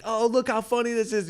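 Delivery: high mock cooing; delighted relatives.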